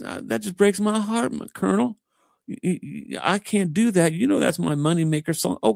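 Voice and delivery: very high, sweet country voice. Retold or imitated. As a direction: plead in a high country tone, hammed-up disappointment. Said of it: "she said in that country tone".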